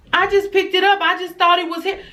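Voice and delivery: put-on high, defensive kid voice; child making excuses. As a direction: imitate a daughter pleading her innocence.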